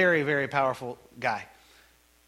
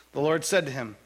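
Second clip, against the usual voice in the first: usual speaking voice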